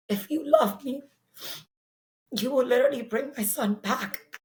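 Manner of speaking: pleading retold prayer, pitch lifting; a pained plea, pausing between phrases. Mother begging God for her son's life, pleading. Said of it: "I kept pleading to God".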